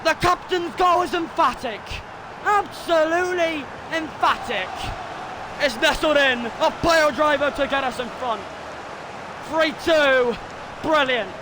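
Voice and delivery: high, stretched-out play-by-play shouting; ecstatic goal call. Real, not acted. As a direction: shout the goal home, drawing out the words. Calls a goal live.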